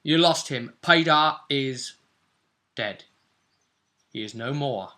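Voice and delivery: ordinary talk, nothing marked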